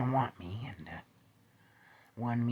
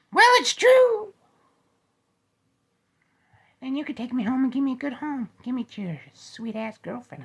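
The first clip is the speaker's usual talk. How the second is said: squeaky bear; 10 semitones higher, 10 dB louder than usual. Acted.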